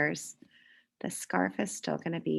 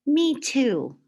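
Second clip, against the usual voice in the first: put-on higher eager little kid voice; child's voice; 7 semitones higher, 6 dB louder than usual. Children in class agreeing with Emma, higher and eager.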